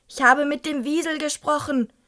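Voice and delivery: a hint of the grater-voiced old lady; rasping old woman. A narrator's reading. Old woman correcting the boy, rasping. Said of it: "raspelte die Frau".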